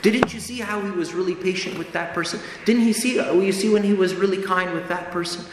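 lightly voiced conscience coaxing you to see the good; coaxing inner voice. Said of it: "The little voice will say".

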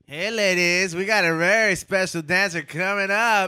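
club emcee announcement. Acted. Strip club emcee introduces dancer.

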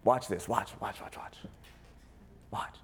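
dropping to a near whisper to draw them in; low, hushed call to watch closely. Real, not acted. Pastor hushing the congregation, low whisper.

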